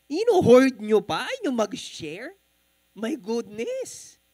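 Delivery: the waiter's mock outrage at greedy diners; incredulous waiter scolding; indignant waiter. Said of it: "sinasabi niya".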